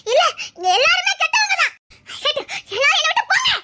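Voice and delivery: sobbing that climbs to a shout; anguished shouting. Acted. Girl sending everyone away in pain, anguished. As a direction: cry out, betrayed and hurt. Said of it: "வேதனையில கத்திட்டு இருக்கா".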